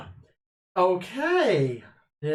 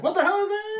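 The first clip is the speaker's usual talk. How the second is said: startled yelp. Acted. Panicked at floating green blade.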